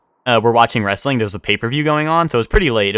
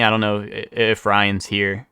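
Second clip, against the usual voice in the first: normal talking voice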